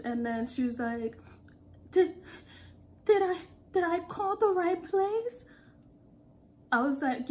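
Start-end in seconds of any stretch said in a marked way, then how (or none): 1.9-5.4 high, halting, stammered question; shaky, terrified caller. Retold or imitated. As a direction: stammer timidly as a scared customer. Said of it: "her voice sounded so shaky".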